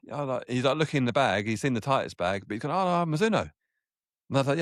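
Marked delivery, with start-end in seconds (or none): 2.7-3.5 high, light imitation of the Mizuno man; mock Japanese rep. Mizuno rep correcting the golfer on the buggy, high mock surprise. Japanese guy sees Titleist bag.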